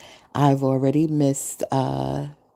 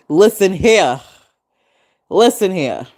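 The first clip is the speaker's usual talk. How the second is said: mock firm; 4 semitones higher, 8 dB louder than usual. Mock mafia lady.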